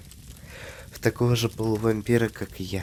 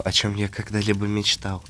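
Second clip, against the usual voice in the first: usual speaking voice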